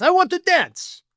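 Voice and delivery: high, loud mock Russian Putin voice; mock Russian accent. Putin demanding to dance, in a high, loud mock Russian accent. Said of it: "it's how I imagine Putin says this".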